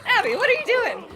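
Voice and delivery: high, loud cry of disbelief with laughter; exclaimed self-scolding. Real, not acted. Streamer to herself after botching the game, laughing loud self-scolding. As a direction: yell at yourself, half laughing.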